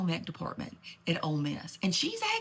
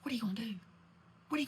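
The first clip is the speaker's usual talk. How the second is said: whisper; 3 semitones higher, 6 dB quieter, much faster than usual. Real, not acted. Whispered rhetorical aside.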